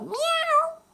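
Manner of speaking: high, loud miaowing cat; cat meow. Acted. Story cat arrives, imitated.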